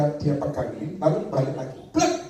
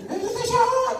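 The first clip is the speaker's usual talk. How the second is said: joyful shout; slower than usual. Acted. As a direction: shout it out high at the bus stop. Man shouts at bus stop.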